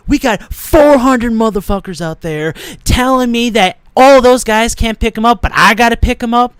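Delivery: trash-talk the boss like a pro wrestler; wrestler promo. Pretend. Talking back to the manager in the ring, loud wrestler rant. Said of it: "And then I'll be like".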